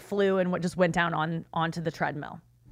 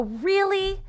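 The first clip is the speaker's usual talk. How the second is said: high, incredulous "Really?"; sarcastic shocked squeak; mock disbelief; 11 semitones higher than usual. Retelling her retort to the gym worker, high incredulous.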